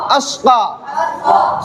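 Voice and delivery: अस्का heavy and rounded, very drawn out; मोटी आवाज. Shown as a demonstration. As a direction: stretch a full emphatic S in अस्का slowly. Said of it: "मोटी आवाज निकालनी है". (अस्का को मोटा, लंबा खींचकर)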